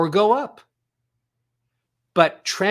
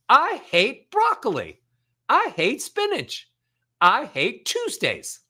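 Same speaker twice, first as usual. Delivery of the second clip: high sing-song complaint, swooping down; melodic, not angry; 8 semitones higher than usual. Shown as a demonstration. Coach proving melody kills anger, high sing-song hating. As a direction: gripe with big playful swoops of melody. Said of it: "you added more melody".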